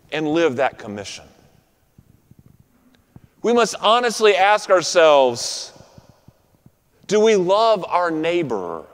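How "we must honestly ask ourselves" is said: ordinary talk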